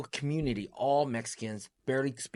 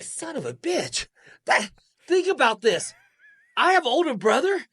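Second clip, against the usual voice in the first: much higher and louder, building outrage; worked-up disbelief; 11 semitones higher, 8 dB louder than usual. Real, not acted. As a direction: pour out the shock of being kept in the dark. Reliving shock of hidden brother.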